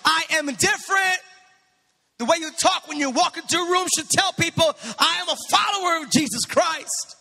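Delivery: high, forceful preacher's delivery; impassioned preaching. Real, not acted. Pastor urging followers of Christ to be different, impassioned preaching. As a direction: preach it with rising intensity, pitched high.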